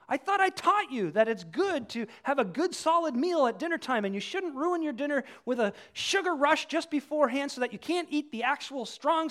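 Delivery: high, loud mock disbelief; hammed-up parental rant, pitched well up; exasperated parent. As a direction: scold your grown child in acted outrage. Acts exasperated parent scolding grown child.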